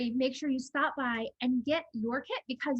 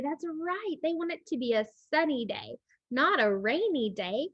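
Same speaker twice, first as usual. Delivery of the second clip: ordinary delivery, nothing marked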